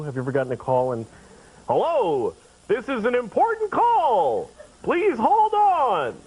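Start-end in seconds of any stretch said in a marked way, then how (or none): 1.7-6.1 slow, stretched announcement, rising high; automated hold message. Acted. Phone system calling him to say hold, slow announcer voice. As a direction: draw out each word like a recorded operator. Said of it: "that call today where Hold calls you".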